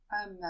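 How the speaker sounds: "am" as an open A and a fully sounded M; non-nasal sound. Shown as a demonstration. Teacher showing the exception for double M, non-nasal. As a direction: close the lips clearly on the M of "am". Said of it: "This time it isn't a nasal sound".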